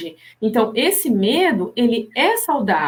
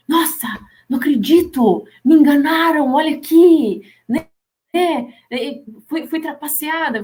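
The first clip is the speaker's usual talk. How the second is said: mock indignant rant; 4 semitones higher than usual. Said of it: "você fica com raiva".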